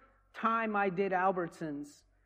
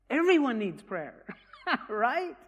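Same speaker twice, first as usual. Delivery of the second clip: says it with a laugh, higher and louder; 5 semitones higher, 5 dB louder than usual. Real, not acted. To the audience about the woman's couch, laughing. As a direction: laugh through the line, amused.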